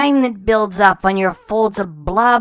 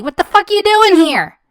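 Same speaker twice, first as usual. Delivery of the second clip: angry outburst; 7 semitones higher, 4 dB louder than usual. Real, not acted.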